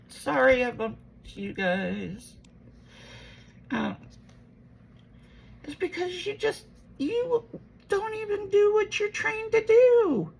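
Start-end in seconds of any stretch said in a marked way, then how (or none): 5.6-10.3 much higher, heated reproach; exasperated accusation. Retold or imitated. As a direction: call her out, voice climbing. Retells arguing with her mom.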